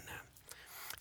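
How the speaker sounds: breathy whispered aside. Acted. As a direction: whisper the lead-in.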